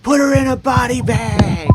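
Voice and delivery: high, loud drawn-up heckler yell; yelling from the crowd. Acted. Game master as another spectator at the match, yelling. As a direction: holler it over the crowd at the wrestler. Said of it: "someone else yells out".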